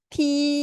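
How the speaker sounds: 티 held high and level; first tone. Shown as a demonstration. Teacher showing students first tone, high level 티. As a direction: stretch 티 on one high pitch. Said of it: "고음에서 이 수평으로 읽어줘야".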